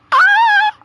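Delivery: short, very high nervous squeal. Real, not acted. Nervous gamer before the big choice, high squeal. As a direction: let out a high "ah".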